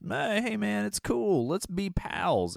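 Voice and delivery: high, slow, easygoing calming voice; mock laid-back peacemaker; placating Maverick. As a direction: soothe the gunman, drawn out.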